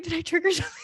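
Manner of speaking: startled high-pitched sorry; imitating a shocked coach. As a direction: play the coach caught off guard. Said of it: "she was like".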